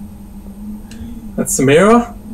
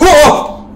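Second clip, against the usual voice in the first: sharp gasp into high cry; sudden high "Oh"; startled gasp; 11 semitones higher than usual. Real, not acted. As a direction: gasp and yelp in fright. Jump scare in the game.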